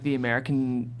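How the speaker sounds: ordinary delivery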